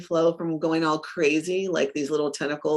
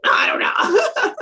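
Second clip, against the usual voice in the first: mock whine; 5 dB louder, slower than usual. Mock procrastinator shrug.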